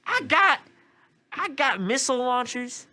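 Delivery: high, loud granny voice; man doing a woman's voice. Acted. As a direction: brag like a gun-toting grandma. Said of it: "She's like".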